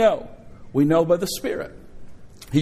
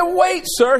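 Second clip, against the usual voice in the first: calling out sharply; 11 semitones higher, 3 dB louder than usual. Retold or imitated. Calling attorney back in court.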